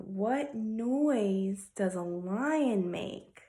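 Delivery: normal talking voice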